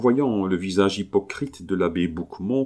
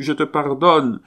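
higher and louder, almost crying it out; desperate forgiveness; 8 semitones higher, 6 dB louder than usual. Acted. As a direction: shout the pardon to make her hear. Husband begs his dead wife. (pardon crié, plus aigu et plus fort)

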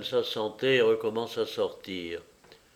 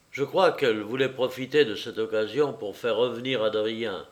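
usual speaking voice

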